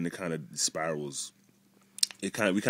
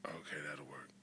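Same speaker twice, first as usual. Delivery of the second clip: soft unvoiced whisper; 3 semitones lower, 18 dB quieter than usual. Real, not acted. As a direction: whisper it close. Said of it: "why are we whispering?".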